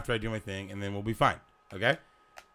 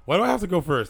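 indignant protest; 6 semitones higher, 5 dB louder, faster than usual. Real, not acted. Mock argument over who goes.

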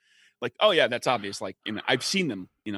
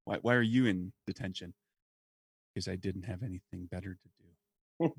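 quoting the movie line softly; 4 semitones lower, 6 dB quieter than usual. Retold or imitated. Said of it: "one of my favorite lines".

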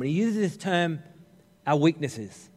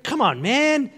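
Joe Biden impression; 9 semitones higher, slower than usual. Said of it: "Gone all Joe Biden on him".

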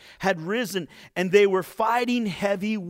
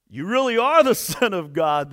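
louder, with a laugh of wonder; awed exclamation; 2 semitones higher, 7 dB louder than usual. A narrator's reading. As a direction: exclaim it in joyful awe. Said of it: "they exclaimed".

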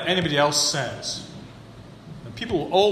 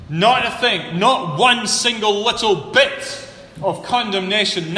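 rising higher and louder, slowing on key words; building emphasis; 6 semitones higher, 4 dB louder than usual. Real, not acted. Preacher urging the church there is no condemnation. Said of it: "But in the Greek".

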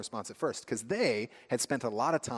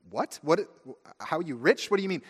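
mock baffled; 6 semitones higher, 5 dB louder than usual. Baffled reply to the rich friend.

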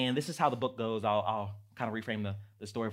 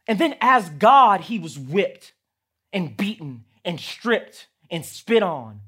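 loud, higher, fervent preaching; full-force preaching; 7 semitones higher, 14 dB louder than usual. Acted. Pastor telling of the cross, fervent loud preaching. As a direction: build the sermon to its climax.

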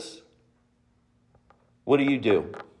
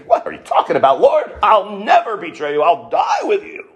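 shouted, rising indignant protest; Peter protesting; 4 semitones higher than usual. Retold or imitated. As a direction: cry out your loyalty, offended.

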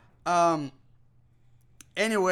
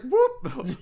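high little whoop; mock dodging whoop; 13 semitones higher than usual. Old guy sidestepping a charge, high comic whoop. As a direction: toss out a high, laughing whoop.

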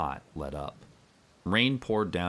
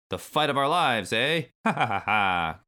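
loud mocking reptile laugh; villain cackle; 4 semitones higher, 5 dB louder than usual. A narrator's reading. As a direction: cackle like an evil lizard boss. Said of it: "cackled Clump".

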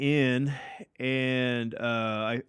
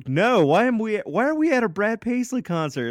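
higher and louder retold indignant protest; upset outburst; 10 semitones higher, 5 dB louder than usual. Me to Jeffrey about the concert, a higher, louder upset outcry. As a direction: squeal it out, fed up and baffled. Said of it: "And I'm getting all upset".

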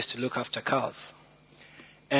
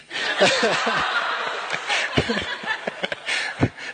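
high unvoiced giggle at his own joke; own laughter; 8 semitones higher than usual. Real, not acted. Speaker laughing at his own pun. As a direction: laugh at the pun you just told.

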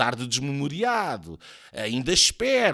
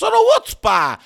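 high, loud flustered splutter; hammed-up exasperated stammer; 10 dB louder, faster than usual. A narrator's reading. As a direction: choke out the evasive answer. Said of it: "engasga Soares".

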